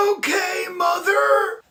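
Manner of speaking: obey while barely managing the words; sickly obedience. Acted. Sick boy obeys his mother, sounding ill.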